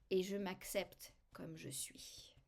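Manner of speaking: soft slow whisper; whispered self-acceptance mantra; whispered affirmation. Shown as a demonstration. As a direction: breathe the words out gently. Self-acceptance affirmation after tapping.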